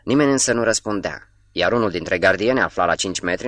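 plain reading voice

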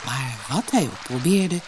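usual speaking voice

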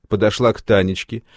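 ordinary delivery

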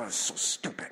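whispered, half under the breath; whispered insult. Retold or imitated. Driver whispering an insult at another car, under the breath. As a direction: hiss it privately at the other driver. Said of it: "And you're".

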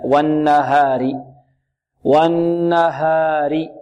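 very slow والنهار, long hummed n and pure open a; correct pronunciation. Shown as a demonstration. Teacher repeating the right form for students, light open ha. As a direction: draw out والنهار with a light h and untilted a. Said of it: "الصحيح".

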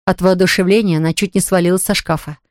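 ordinary delivery, nothing marked

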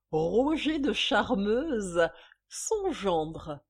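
slow, loud, drawn-out name; triumphant reveal. Acted. Mistress naming who signed the cheque, triumphant. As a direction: savour each syllable of the name.

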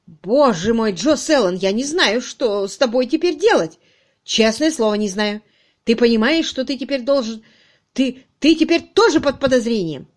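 loud, agitated dismay; worked-up, emphatic boss; exasperated outburst. Acted. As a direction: scold in helpless exasperation. Chief despairs at compromised subordinate.

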